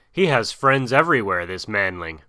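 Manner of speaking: ordinary delivery, nothing marked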